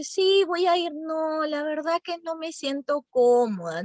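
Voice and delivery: reluctant tone. Shown as a demonstration. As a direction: sound like you don't really want to go. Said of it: "van diciendo esa parte con otro tono".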